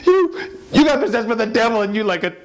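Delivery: high, strained female voice throughout; wife's frantic voice. Acted. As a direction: pitch way up like a panicked wife. Wife recounts possessed night.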